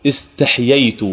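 استحييت as is-taḥ-yay-tu, both yā letters sounded; eloquent form. Shown as a demonstration. Teacher returns to the classical form for students, eloquent pronunciation. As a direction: close the ḥ, then yay in استحييت. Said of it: "لكن اللغة الفصيحة أن يقول".

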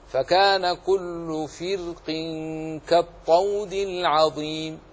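slow recitation, "فرق" with a light, thin ر. Shown as a demonstration. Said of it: "إن شئت رقق كما قرأت". (ترتيل مع ترقيق الراء في "فرق")

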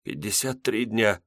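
barely above a whisper. A narrator's reading. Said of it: "также шёпотом ответила жена".

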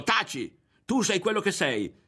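high-pitched mock yell; retold yell. Berto retells yelling at the girl as he hit her. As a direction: fling the insult while punching. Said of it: "Le ho dato un pugno urlando".

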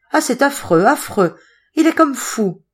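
high, loud cry of horror; distressed. A narrator's reading. Zoé describes the madman, distressed and horrified. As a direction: lament it in horrified agitation.

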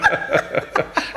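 тихий смех на выдохе; посмеяться про себя, без голоса; беззвучный смешок. Acted. Смех после насмешки. (смешок сквозь зубы)

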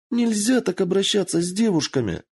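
put-on high fox girl protesting; indignant squeal. A narrator's reading. Kitsune defending herself from the floor, indignant.

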